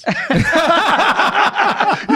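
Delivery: loud high laughing burst; burst of laughter. Real, not acted. Host bursting out laughing at the arrest story. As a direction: crack up at the surprise. Hearing guest was arrested.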